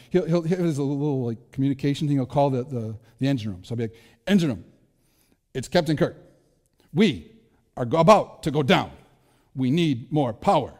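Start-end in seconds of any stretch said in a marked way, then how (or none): 4.3-10.7 overacted starship captain with halting pauses; hammed-up Captain Kirk. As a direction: ham it up, breaking the lines into choppy bursts. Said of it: "super overacts all the time".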